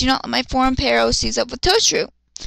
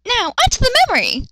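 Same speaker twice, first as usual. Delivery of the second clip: high, fast, loud announcer voice; mock grand segue; 11 semitones higher, 4 dB louder, faster than usual. Reader announcing the flashback to viewers, high and fast. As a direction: sweep the audience into the flashback.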